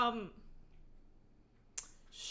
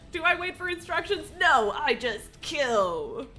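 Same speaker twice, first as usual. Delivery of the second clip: mock assassin; 6 semitones higher, 8 dB louder, slower than usual.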